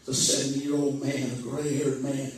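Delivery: low, soft, somber telling; hushed sorrow. Acted. Preacher describing the lost, hushed and somber. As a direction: let it sink in quietly.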